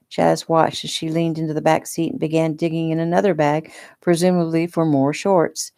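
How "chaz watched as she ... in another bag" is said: plain reading voice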